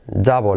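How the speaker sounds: "Double" as "dabo"; near-silent L. Shown as a demonstration. Teacher repeating the correction, "Double" without audible L. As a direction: let "Double" end on an O, hardly any L. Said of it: "la L es casi insignificante".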